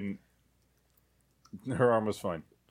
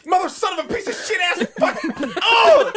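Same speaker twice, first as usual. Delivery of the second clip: high, loud string of curses through laughter; comic outburst of agonized swearing; mock cursing fit; 9 dB louder than usual. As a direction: yell a hammed-up pained swearing fit. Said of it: "Or my personal favorite".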